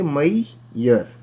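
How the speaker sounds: मैयर very slowly, "mai... yar"; two separate syllables. Shown as a demonstration. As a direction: gap between the halves of मैयर. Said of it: "ये दो syllables हैं इसके अंदर". (मैयर दो टुकड़ों में, धीरे)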